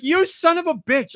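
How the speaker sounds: mock cry of betrayal at the screen; mock outburst. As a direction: act out being robbed of the moment. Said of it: "I'm like".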